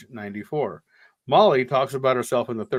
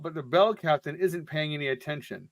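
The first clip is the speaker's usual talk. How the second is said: normal talking voice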